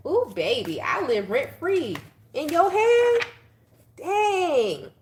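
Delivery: high and loud, mocking; gleeful taunt. Real, not acted. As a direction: taunt the troll with delight.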